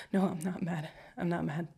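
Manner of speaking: usual speaking voice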